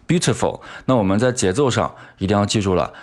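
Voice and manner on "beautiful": beautiful chopped short like slicing fruit, Chinese accent; higher, choppy Chinese-accented beautiful. Shown as a demonstration. Teacher imitates students' mistake, choppy Chinese accent. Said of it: "那很多同学都是这么读的".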